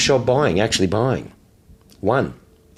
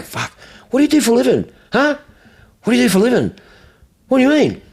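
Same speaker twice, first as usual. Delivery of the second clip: high-pitched, needling question; mock exasperated challenge; 10 semitones higher, faster than usual. Dealer asks caller his living, mock exasperated.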